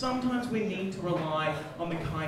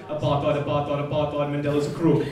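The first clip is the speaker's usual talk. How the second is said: low-pitched mock South African accent; 5 semitones lower, 4 dB louder than usual. Shown as a demonstration. Comedian shows off accents to the crowd, low South African accent. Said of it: "I could do a South African accent".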